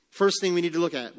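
ordinary delivery

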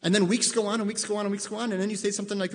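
normal talking voice

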